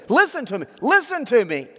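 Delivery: high, loud listen to me; urgent call. Retold or imitated. Hosea calling out to get their attention, urgent and loud. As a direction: demand attention now. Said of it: "So he says it very fast".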